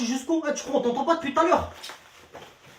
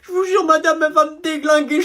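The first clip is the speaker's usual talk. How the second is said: ordinary delivery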